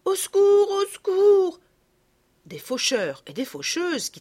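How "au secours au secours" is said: put-on high, loud child's scream for help; frantic high-pitched shout; cry for help. A narrator's reading. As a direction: scream for rescue, voice thrown high. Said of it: "en criant".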